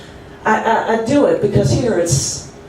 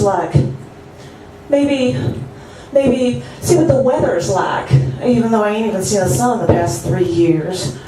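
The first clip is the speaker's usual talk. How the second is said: ordinary delivery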